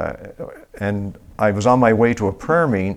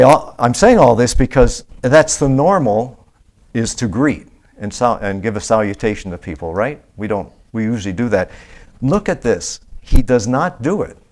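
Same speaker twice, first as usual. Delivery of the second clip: usual speaking voice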